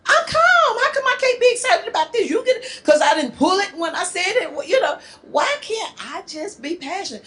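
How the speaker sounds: very high-pitched, excited protest; worked-up protest. Acted. Partner protests being told to calm down, worked-up and high. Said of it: "when we are angry or excited".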